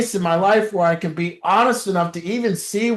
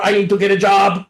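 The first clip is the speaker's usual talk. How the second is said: usual speaking voice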